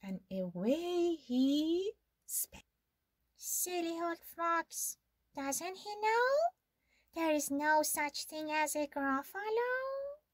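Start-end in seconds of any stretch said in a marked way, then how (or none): 3.4-10.2 higher, amused mouse to herself; lightly voiced smug mouse; smug little mouse. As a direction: chuckle at the fooled fox.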